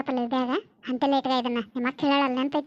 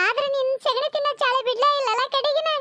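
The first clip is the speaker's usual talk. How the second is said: tearful, higher-pitched accusation; crying reproach; 4 semitones higher than usual. Acted. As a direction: reproach him through tears. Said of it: "ಅಳ್ಬೇಡ, ಏನು ತೊಂದ್ರೆ ಆಗಲ್ಲ". (ಅಳುತ್ತಾ ದೂರುವ ದನಿ)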